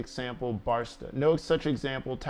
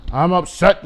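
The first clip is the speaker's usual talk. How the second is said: sudden much louder burst; loud outburst; 2 semitones higher, 10 dB louder than usual. Real, not acted. Venting to his dog Link, sudden loud outburst. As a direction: blurt it out in frustration.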